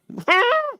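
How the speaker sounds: short high-pitched burst of laughter; quick, high burst of amusement. Real, not acted. Laughing at his own story.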